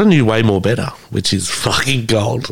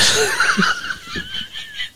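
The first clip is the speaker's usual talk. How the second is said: sudden high shrieking laugh; 6 dB quieter than usual. Real, not acted. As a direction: burst out laughing. Laughs at own retort.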